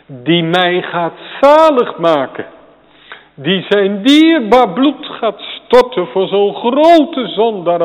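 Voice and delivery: impassioned pulpit plea; fervent preaching. Real, not acted. Preaching at full force.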